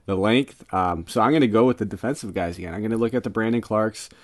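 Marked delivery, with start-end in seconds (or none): none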